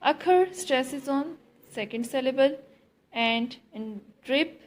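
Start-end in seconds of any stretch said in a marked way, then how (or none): none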